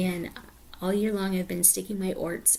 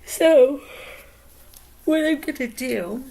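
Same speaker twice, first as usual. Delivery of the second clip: talking through a big yawn; words stretched by a yawn; 6 semitones higher, 10 dB louder than usual. Real, not acted. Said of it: "sorry I'm yawning".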